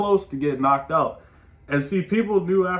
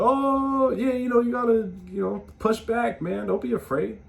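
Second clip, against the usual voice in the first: mock encouragement; 7 semitones higher than usual. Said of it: "they'll be like".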